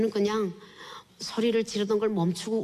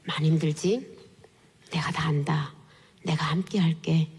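unvoiced whisper, lower, close behind her; God's gentle voice; 5 semitones lower than usual. God comforting the weeping woman from behind, whispered. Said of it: "음성이 들려요".

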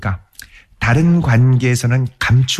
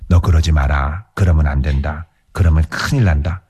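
lower, coaxing parent voice; 10 semitones lower than usual. Retold or imitated. Parent coaxing a straying child, lower and persuasive. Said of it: "첫 번째는 뭐냐면 회유합니다".

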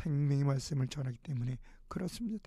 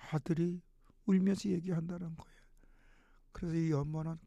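usual speaking voice

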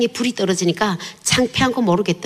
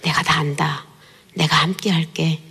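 gentle whisper; 4 semitones lower than usual. Retold or imitated. God embracing her from behind, hushed comforting whisper. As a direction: breathe it like a voice close behind her. 음성이 들려요.

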